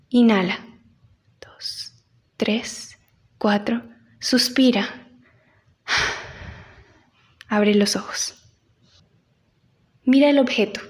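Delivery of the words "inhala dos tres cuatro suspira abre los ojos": counting breaths, fading to whisper; slow breath counting. Shown as a demonstration. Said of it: "leads breathing exercise".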